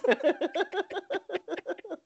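high helpless giggling fit; long run of high, quiet giggles; laughter. Real, not acted. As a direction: crack up at your own impression. Cracks up at own impression.

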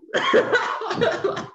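high burst of laughter; a loud laugh breaking out. Real, not acted. Storyteller laughing at his own stubborn refusal.

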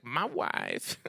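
drawn-out mock Kazakh accent; Borat. Husband answering with a movie quote, Borat accent. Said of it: "I was watching Borat. And he's like".

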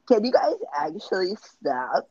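exaggerated slow complaint. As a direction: drag out the words as a mock annoyed girl. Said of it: "did she have the Streets voice".

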